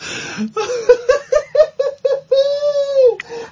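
high helpless cackle. Real, not acted. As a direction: break into a big laugh. Bursts out laughing.

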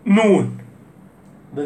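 ordinary delivery

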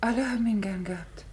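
a woman whispering after hearing of a friend's murder. Acted. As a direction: breathe the question in shock. Shocked woman whispers about murder.

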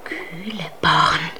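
whisper. Acted. Undine soothes Bertalda in a whisper. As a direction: whisper a calming spell.

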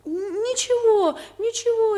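high, flustered stammer. Acted. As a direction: stammer it out nervously. Flustered reassurance to visitors.

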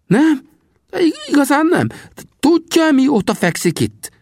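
very high, loud disbelief; stammering, excited high character voice; astonished Sam. A narrator's reading. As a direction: question in high-pitched amazement.

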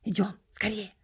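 hushed, hurried aside; secretive whispered urging; urgent whisper. A narrator's reading. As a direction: whisper it so only one person hears. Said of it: "а мне шепнул".

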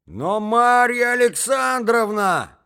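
very high, loud, drawn-out protest; exasperated shout. Acted. Young man jumps up from his chair, shouting in protest. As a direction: shout her name in stretched-out outrage. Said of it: "вскричал он".

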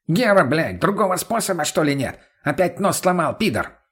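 high, furious complaint; angry yelling. Acted. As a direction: yell it out in outrage. Broken-nosed addict yells at narrator.

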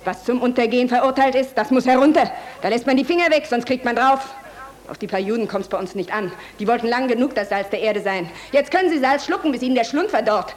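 loud, venomous threat; fanatic tirade. Acted. Woman threatening the general in a loud fanatic tirade. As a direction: spit the threat at him.

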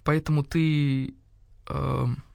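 slow "поэтому ты", trailing into "эм"; "поэтому ты" drawn out, then a sheepish "эм"; faltering break-off. A narrator's reading. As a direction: stop short after "ты" with an awkward "эм". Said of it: "барсук вдруг осёкся".

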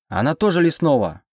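high, urgent cry; yelled lie. A narrator's reading. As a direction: yell it out to convince them. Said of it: "заорала я откровенную ложь".

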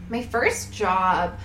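ordinary talk, nothing marked

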